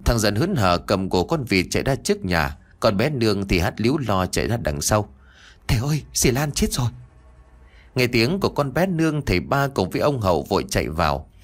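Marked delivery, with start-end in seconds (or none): 5.7-6.9 put-on frightened child. Child girl cries out.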